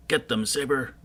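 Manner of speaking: high, loud urging of the dog; urgent command. Acted. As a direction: push the dog into the fight.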